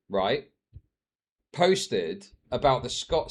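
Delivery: normal talking voice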